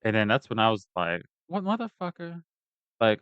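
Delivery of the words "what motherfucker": incredulous outrage. Retold or imitated. Retelling his reply to the guards, incredulous. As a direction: replay being indignantly taken aback.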